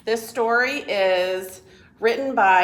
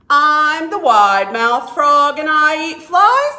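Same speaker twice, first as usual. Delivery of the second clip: loud, boastful frog voice, much louder; proud frog; 5 semitones higher, 12 dB louder than usual. Acted. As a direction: announce it like a showy frog. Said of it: "said the wide-mouthed frog".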